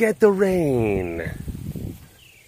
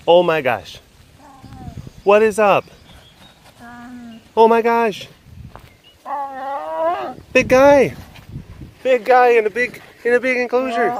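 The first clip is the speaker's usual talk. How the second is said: excited greeting; 7 semitones higher than usual. Real, not acted. Greeting the big cat excitedly.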